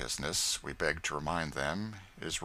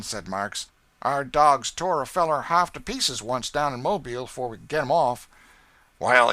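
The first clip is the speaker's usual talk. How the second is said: louder dialect character talk; traders talking dogs; 5 semitones higher, 7 dB louder than usual. Acted. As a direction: weigh it up coldly. Haley and Marks on dogs.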